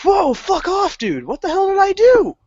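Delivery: a sudden high yelp of shock at the guard; mock-offended high squawk of disbelief; startled outburst. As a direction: throw up your hands, caught off guard. Snapping back at the guard.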